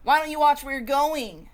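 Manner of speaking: loud, high angry snap; sour snap. Acted. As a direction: bite their head off. Sour grape snapping at passerby.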